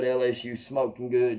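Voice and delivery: ordinary talk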